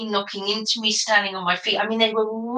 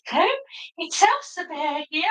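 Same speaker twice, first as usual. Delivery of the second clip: Elsa Bear voice; 7 semitones higher than usual. Acted. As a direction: greet the audience as prim Elsa Bear, pitched up. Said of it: "which voice I was being so I knew which voice to do".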